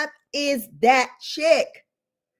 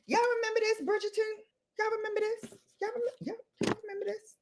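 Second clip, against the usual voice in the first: mock hushed tease; 10 semitones higher, 10 dB quieter, much faster than usual. Taunting hushed high voice.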